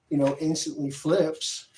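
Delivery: ordinary talk, nothing marked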